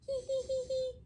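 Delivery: soft, high little giggle. Acted. New friends giggling together, high and soft. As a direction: giggle like a delighted child.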